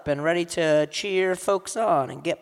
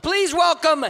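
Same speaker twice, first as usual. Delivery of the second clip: hype announcer; 6 semitones higher, 3 dB louder than usual. Real, not acted. Host kicking off Verselandia and welcoming Danica Leong, hype announcer call. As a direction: launch the show with big energy.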